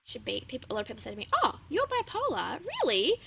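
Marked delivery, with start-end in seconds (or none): 1.3-3.2 high, drawn-out mock disbelief that climbs at the end; mock surprise. People reacting to hearing she's bipolar, in mock surprise. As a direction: imitate someone amazed you have a job. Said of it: "they get really surprised".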